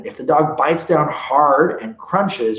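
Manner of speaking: ordinary talk, nothing marked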